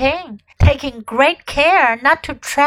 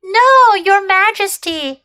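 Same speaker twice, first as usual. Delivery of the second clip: put-on eager boy; 7 semitones higher, 3 dB louder than usual. A narrator's reading.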